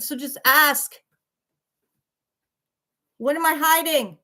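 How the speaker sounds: defiant challenge. Real, not acted. Challenging chat to ask anything.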